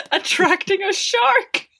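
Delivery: slow, high, laughing quote; cracking up mid-line; real laughter. Real, not acted. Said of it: "laughs while quoting".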